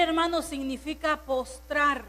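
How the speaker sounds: normal talking voice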